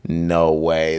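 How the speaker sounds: ordinary talk, nothing marked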